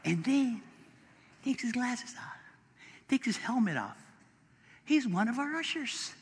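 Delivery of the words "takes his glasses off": hushed storyteller's whisper; whispered aside building suspense. A narrator's reading. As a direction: drop to a whisper for the reveal.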